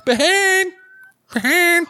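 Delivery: high, loud calling. Acted. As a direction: shout the name across the forest. Searchers calling out name.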